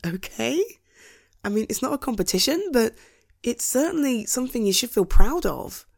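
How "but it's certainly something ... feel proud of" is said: ordinary delivery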